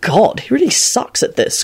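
mildly dry aside. A narrator's reading. Quentin watching Lupin fumble, dry. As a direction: remark drily from the sidelines. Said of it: "Quentin commented dryly".

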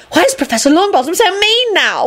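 high, whining schoolgirl; screech. A narrator's reading. Lydia protesting the professor's meanness, screeching. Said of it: "Lydia screeched".

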